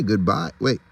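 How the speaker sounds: ordinary delivery, nothing marked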